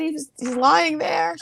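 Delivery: high, weepy retelling; imitating herself in tears; mock sobbing. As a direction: whimper it in a high voice. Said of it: "I'm sobbing".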